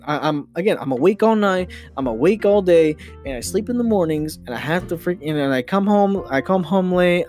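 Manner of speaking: ordinary talk, nothing marked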